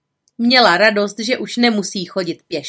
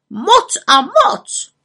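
high, loud, slow squawk; exclaiming raven; 10 semitones higher, 7 dB louder, much slower than usual. A narrator's reading. Raven insists to the witch it matters, exclaiming. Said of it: "zvolal Havran".

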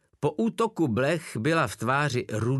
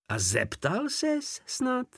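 much higher, slow, smiling tease; slower than usual. A narrator's reading. Porta teasing Wolf with a smile. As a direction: smile through the sly question. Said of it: "usmál se Porta".

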